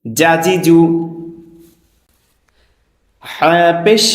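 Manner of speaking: slow, measured teacher's chant; spelling drill recitation. Shown as a demonstration. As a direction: recite each letter deliberately, vowel drawn out. Leads a letter-spelling drill.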